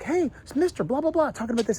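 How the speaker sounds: put-on high-pitched child calling out; imitated excited kid. Kid hailing the man down the street, imitated high-pitched call. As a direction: pipe up like a chattering kid.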